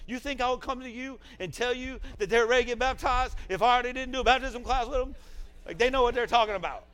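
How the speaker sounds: loud, high-pitched mock scolding; retold argument, shouted high and indignant; imitating indignant scolding. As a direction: act out her outraged challenge at full volume. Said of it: "that's how we talk to each other".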